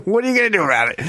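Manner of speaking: mock taunting challenge. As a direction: goad him, daring him to act. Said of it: "this is the classic line".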